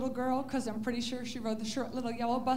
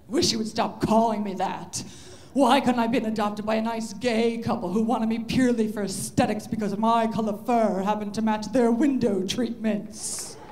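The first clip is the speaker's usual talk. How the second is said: snooty cat; 4 semitones lower, 7 dB louder than usual. Pretend. Cat complains to its owner.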